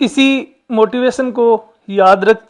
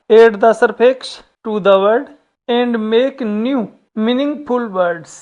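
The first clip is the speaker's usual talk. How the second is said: very slow, word by word; slow reading aloud; much slower than usual. Shown as a demonstration. Teacher reading the English task to students, very slow. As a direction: spell out each word slowly for the class.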